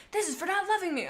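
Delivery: high, tearful mock screech. Chaser rereads his attack line with a mock tearful wail. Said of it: "Chaser screeched".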